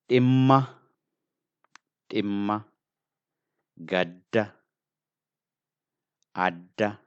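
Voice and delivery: Imma, Gadda, Adda, Yakka, Biya, Kiya each with the middle consonant doubled; strong consonants. Shown as a demonstration. Teacher modelling strong words for learners, doubled consonants. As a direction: hold each middle consonant, then release it firmly. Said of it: "the words that are strong".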